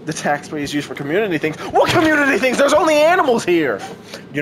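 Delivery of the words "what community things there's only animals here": high, loud indignant yell; outraged shout. Real, not acted. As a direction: scream it in disbelief. Outraged at the tax robot.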